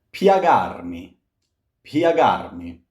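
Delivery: "Piagarmi" with glide pya, pya-GAR-mi; glide demo. Shown as a demonstration. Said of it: "This here is a glide".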